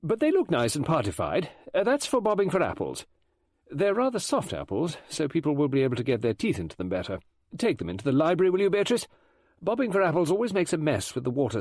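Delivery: high, busy hostess voice; brisk party organiser. Acted. Voices Rowena Drake organising party.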